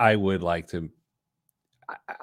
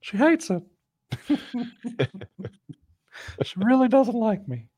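high squeaky cartoon mouse with giggles; Mickey Mouse voice; 11 semitones higher than usual. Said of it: "talk like Mickey Mouse".

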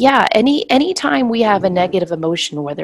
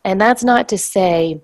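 usual speaking voice

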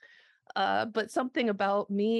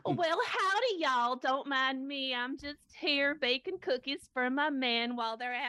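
high, hammed-up Southern belle accent; mock Southern belle; 5 semitones higher than usual. Guest baking cookies for her men, mock Southern belle accent. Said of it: "this lovely Southern belle".

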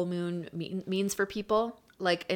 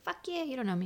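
sassy cat; 6 semitones higher, 9 dB quieter, faster than usual. Retold or imitated. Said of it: "they're like".

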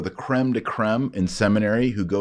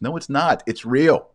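ordinary delivery

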